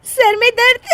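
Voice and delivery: giggling at the words; wife giggling at husband's headache; laughing. Acted. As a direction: laugh despite his pain. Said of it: "इसमें हंसने की क्या बात है".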